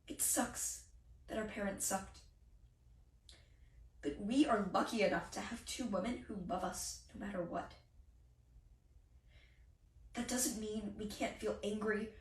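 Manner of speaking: soft, hushed admission; subdued consoling. Acted. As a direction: drop the volume and console gently. Acted tender consoling after outburst.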